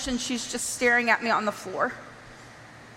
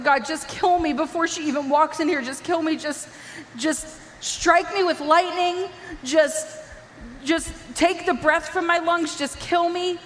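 higher, desperate begging prayer; tearful pleading, halting on each just; weeping plea; 4 semitones higher than usual. Retold or imitated. Daughter begging God to end it before mom walks in, weeping. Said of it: "I was weeping".

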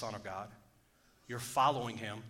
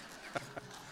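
a soundless breathy chuckle; silent breathy laugh; 12 dB quieter than usual. Real, not acted. Preacher laughing at his own joke, breathy chuckle. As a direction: laugh with air only. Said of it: "I like my own jokes".

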